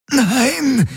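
very high strangled scream; desperate cry. A narrator's reading. Garland fighting his own body, desperate cry. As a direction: cry out against your own hand.

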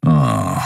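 low, dismissive scoff; contemptuous grunt. A narrator's reading. Kero scoffs at the lovers, contemptuous. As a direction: scoff wordlessly, pitched low. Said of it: "ein verächtliches".

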